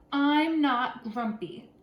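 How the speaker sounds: slow, loud insistence; insisting. A narrator's reading. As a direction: drag it out, firmly denying. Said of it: "Jim insisted".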